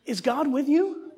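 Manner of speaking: slow emphatic question to the congregation; preaching slowly. Acted. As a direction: drive the question home, slow and firm. Preacher repeats child's line.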